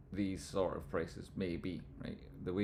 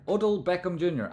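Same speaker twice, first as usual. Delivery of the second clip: excited; 9 semitones higher, 7 dB louder than usual. Real, not acted. Repeats name louder, excited.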